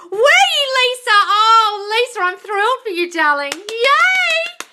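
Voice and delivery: loud, very high celebratory whoop; thrilled cheer. Real, not acted. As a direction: squeal and cheer with real delight. Celebrating viewer's improved health.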